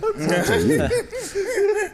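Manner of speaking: mocking broken English. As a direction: mimic the girl's broken English with a laugh. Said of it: "What the fuck?".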